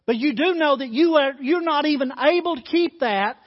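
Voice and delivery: loud, rising sermon delivery, pressing each phrase; preacher urging his congregation toward God's love, preached at full force; fervent preaching. Acted. Preach it hard to the pews.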